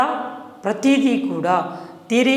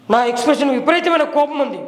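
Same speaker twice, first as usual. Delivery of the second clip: higher, tense angry voice; mismatched anger; 6 semitones higher than usual. Shown as a demonstration. Teacher demonstrates anger that doesn't match the body. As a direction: say it angrily while the hands stay still. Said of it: "ఇలా అన్నా".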